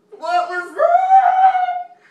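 high, slow frightened yell; scared shout. Real, not acted. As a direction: shriek it out in fright. Spooked by a noise.

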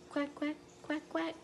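high quacking; duck quack. Retold or imitated. Teacher to children, high duck quacks.

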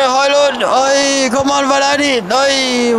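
high shout urging a player on; excited urging. Real, not acted. Gamer urges his striker on, excited shout. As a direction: yell at the screen to push him on.

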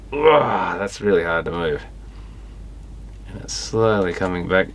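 usual speaking voice

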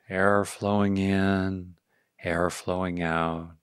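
calm, unhurried meditation voice; guided meditation. Real, not acted. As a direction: ease listeners into awareness, leaving space after each phrase.

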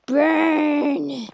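"Burn!" cried out high and loud; shouted burn. Real, not acted. Gamer shouts at something burning in the game. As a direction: yell "Burn!" at the fire. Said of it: "burn repeated loud".

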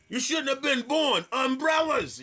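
high, loud imitation of a drunk heckle; drunk heckler's yell. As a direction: yell nonsense like a sloshed heckler. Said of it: "'cause she's yelling shit".

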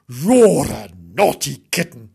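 slow, loud telling-off; scolding dog. Acted. Dog telling off a naughty kitten, scolding.

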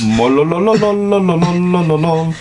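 high, goofy tongue-rolling trill; silly warble. Pretend. Reader mocking the princess with a goofy warbled trill. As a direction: warble nonsense like a silly noise. Nonsense chant mocking name.

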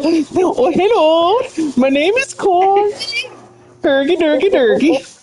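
high, sing-song mock Swedish gibberish; Swedish Chef voice. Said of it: "You sound like Swedish chef".

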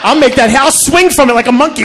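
normal talking voice

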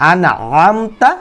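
slow, drawn-out verse recitation; Quran recitation. Shown as a demonstration. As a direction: recite slowly, stretching the long vowels and the final syllable.

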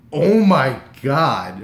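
loud, long-drawn awe; freaking out. Retold or imitated. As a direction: stretch it out loudly, overwhelmed. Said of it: "I freak out".

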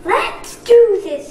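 rally the team; eager call. Acted. Cole rallying the ninja, high and loud. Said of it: "said Cole".

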